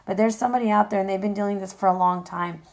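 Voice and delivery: normal talking voice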